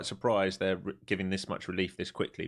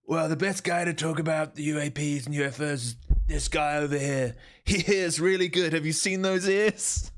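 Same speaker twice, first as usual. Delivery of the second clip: high put-on voice, louder toward the punchline; mock retold speaker; 8 semitones higher, 3 dB louder than usual. Streamer re-enacts the ear jibe at the NASA guy, high mocking voice. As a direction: imitate the joker, climbing higher to the end.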